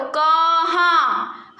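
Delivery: कहाँ stretched very slowly, nasal आ held; drawn-out demo word. Shown as a demonstration. Teacher asking pupils to pronounce, slow drawn-out कहाँ. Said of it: "देखो आप pronounce करो".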